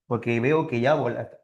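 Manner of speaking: normal talking voice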